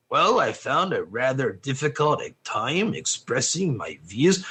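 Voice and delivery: low, soft, droning academic voice; mock the dry intellectual drone; Noam Chomsky impression. Mocking Chomsky to the class as empty talk, low droning voice. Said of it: "That's Noam Chomsky".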